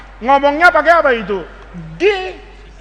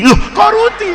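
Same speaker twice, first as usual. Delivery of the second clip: high, baffled question; startled teacher; 8 semitones higher than usual. Acted. Teacher hears a pupil misread, high startled query. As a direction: pitch up in mock astonishment.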